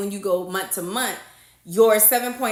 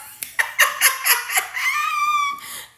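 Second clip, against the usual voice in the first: high-pitched cackling laugh; long, high burst of laughter; big real laugh. Real, not acted. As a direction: crack up after your own singing. Cracks up after singing.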